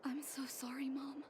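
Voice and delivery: "I'm so sorry" breathed as a whisper; whispered apology. Acted. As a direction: barely voice it, overcome. Daughter apologizes to mother.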